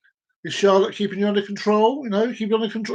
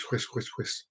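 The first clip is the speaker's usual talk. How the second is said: fading echo; 7 semitones lower, 16 dB quieter than usual. Pretend. Echo effect on word.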